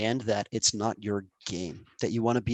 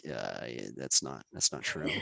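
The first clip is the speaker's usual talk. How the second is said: much lower and softer, half laughing; doubtful mutter; 11 semitones lower, 7 dB quieter, slower than usual. Retold or imitated. Team member disbelieving the boss, a hint of a sceptical mutter. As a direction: grumble it under the breath.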